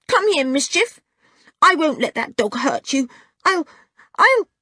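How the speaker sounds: put-on high-pitched, worked-up child's voice; defiant small boy. A narrator's reading. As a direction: protest fiercely, stammering to a halt. Small boy defending his monkey, fiercely.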